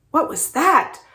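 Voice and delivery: high-pitched, restrained shout. A narrator's reading. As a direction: call out in alarm from another room. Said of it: "Harabaji shouts from the living room".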